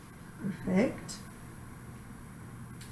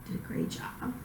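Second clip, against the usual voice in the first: whispering; 17 dB quieter than usual. Real, not acted. Praising patient during exam.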